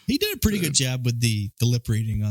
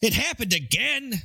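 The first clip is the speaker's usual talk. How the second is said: high, drawn-out, disbelieving chief; imitating an exasperated chief; exasperated police chief; 8 semitones higher than usual. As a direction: whine it slowly as a fed-up police boss. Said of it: "L A police chief coming down being like".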